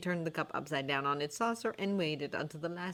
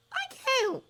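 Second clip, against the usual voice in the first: put-on demanding girl voice. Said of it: "Hermione demanded".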